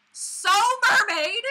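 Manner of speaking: high, loud, slow squeal; squealed shout. Real, not acted. Vlogger gushing loudly about her Luna monofin. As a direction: belt it out so the mic jumps. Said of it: "that totally just made the mic jump".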